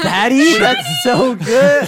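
laughing. Real, not acted. Laughing at a joke.

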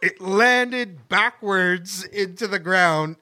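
ordinary delivery

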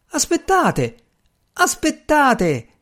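high-pitched call after someone leaving; urgent high cry, not at full volume. A narrator's reading. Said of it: "gridò il dottor Grey Still".

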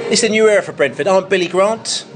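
normal talking voice

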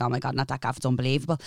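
barely breathing it out in awe; awed hush. Retold or imitated. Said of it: "I was like".

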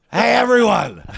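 loud, high strained call; ailing king impression. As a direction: strain to hail the whole room, hammed up. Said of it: "I can do an impression of him".

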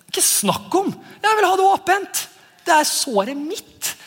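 high, indignant mock refusal; defensive. Preacher acts out refusing to let go of the wound, defensive. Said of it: "da får vi sånn defense".

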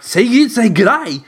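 high, loud mock quote; imitating the co-host. Retelling co-host's remark about g'day, high loud imitation. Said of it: "and you're like".

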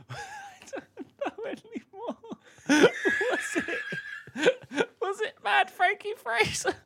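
high squeaky laughing guess; giggling while asking, high and helpless. Real, not acted.

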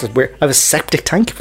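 ordinary delivery

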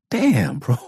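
high mock exasperation; annoyed dad. Tired dad at a nightmare, high and exasperated. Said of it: "initially I'm like".

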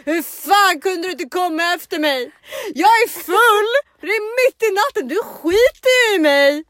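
very high, loud, drawn-out reproach; hammed-up angry wail. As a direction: yell it like a wronged drunk girlfriend, stretching the words. Acted drunken reproach to boyfriend. (högt, gällt, överdrivet anklagande skrik)

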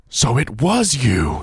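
slow, loud horrified accusation; a hammed-up horrified cry. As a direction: cry out in shock at the discovery. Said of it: "he cried".

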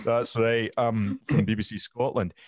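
lightly put-on older Scottish reporter; a hint of a seasoned BBC man. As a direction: play a confident forty-something journalist. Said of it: "I turned from a twenty-five-year-old into a forty-odd".

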